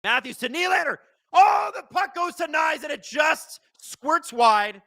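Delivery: high, loud rush of hockey calling; excited play-by-play. Acted. Streamer calling the late-period power play, excited play-by-play. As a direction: call the rush like a broadcaster.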